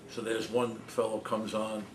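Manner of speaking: ordinary delivery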